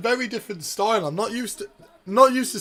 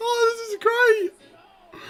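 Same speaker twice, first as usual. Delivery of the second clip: much higher, squeaky voice through laughter; laughing delight; 5 dB louder, slower than usual. Real, not acted. Fan cracking up as Brock kicks his leg. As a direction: giggle the words out, barely holding it together.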